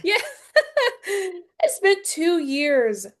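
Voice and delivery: slow words broken by laughter; laughing. Real, not acted. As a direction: laugh through it while half-crying. Laughing at own painful story.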